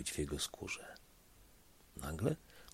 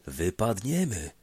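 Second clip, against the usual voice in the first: croaked warning; 4 semitones higher, 5 dB louder than usual. A narrator's reading. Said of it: "krakał pomocnik".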